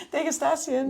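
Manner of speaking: Degustation high, with a European "-STA-si-en" ending; overpronounced foreign word. Shown as a demonstration. Defending her word to co-host, overpronounced Degustation. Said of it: "never heard anyone say it like that".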